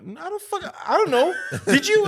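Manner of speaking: retelling a bewildered answer to a sleepy friend; mock panic. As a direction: act flustered and confused. Retelling his panicked reply.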